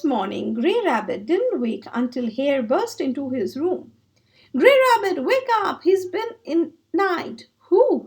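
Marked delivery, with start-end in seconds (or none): 4.6-7.5 high, eager wake-up call; excited. Acted. Hare rousing Grey Rabbit on Christmas morning, excited. 7.7-8.1 surprised cry. Acted. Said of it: "cried Grey Rabbit".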